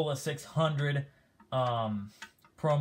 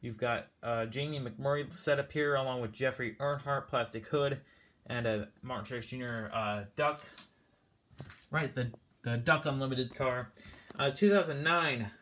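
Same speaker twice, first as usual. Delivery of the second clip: ordinary talk, nothing marked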